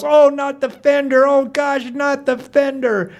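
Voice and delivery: high, pleading cry from mom; anguished yell. Acted. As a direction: wail in dismay as the car gets hit. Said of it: "my mom yells".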